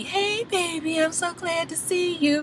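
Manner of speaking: tiny, high-pitched, very soft greeting; happy girlfriend. Retold or imitated. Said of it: "She's all happy".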